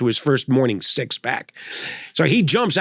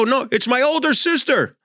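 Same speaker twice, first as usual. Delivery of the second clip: put-on earnest old man; 9 semitones higher than usual. Elderly driver explaining.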